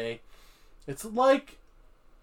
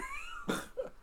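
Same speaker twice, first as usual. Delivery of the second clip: high squealing laugh; 13 dB quieter than usual. Real, not acted.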